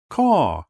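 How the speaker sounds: sharp bird caw; crow caw. A narrator's reading. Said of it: "Do you caw too".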